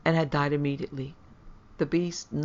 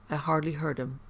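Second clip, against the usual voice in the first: plain reading voice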